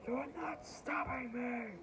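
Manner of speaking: high, drawn-out mock battle cry; defiant hero cry. Hero's defiant cry in comic.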